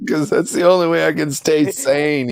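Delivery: high mock whiny friend voice; mocking imitation. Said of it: "he says".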